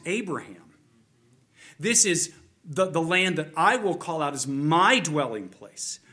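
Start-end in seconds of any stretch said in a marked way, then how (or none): 1.8-6.0 loud, emphatic voice of God; God's declaration. Acted. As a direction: proclaim it loudly, as the owner. Preacher voices God claiming his land.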